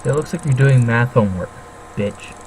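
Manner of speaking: ordinary talk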